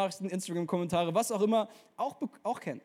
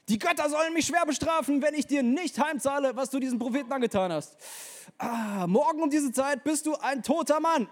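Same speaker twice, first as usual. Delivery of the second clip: higher and louder, vengeful; threatening menace; 7 semitones higher, 3 dB louder than usual. Acted. Isabel threatens Elia by message, higher and louder. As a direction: hurl the threat like a furious queen.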